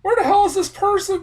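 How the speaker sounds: very high, loud incredulous question; mock exasperated cry; baffled outburst. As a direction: throw your hands up in disbelief. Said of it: "'cause it's like".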